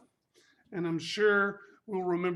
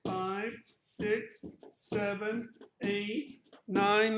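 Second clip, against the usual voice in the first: usual speaking voice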